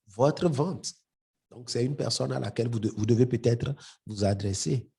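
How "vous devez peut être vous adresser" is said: ordinary talk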